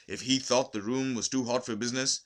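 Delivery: ordinary delivery, nothing marked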